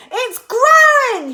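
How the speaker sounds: high, loud excited yell; high loud boy's excited yell; George yelling. A narrator's reading. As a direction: yell it to Grandma. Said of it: "he yelled".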